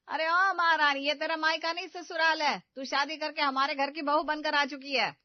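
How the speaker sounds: loud sarcastic scolding; stern mother-in-law reprimand. Acted. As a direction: tell her off, loud and sharp. Mother-in-law scolding lazy bride.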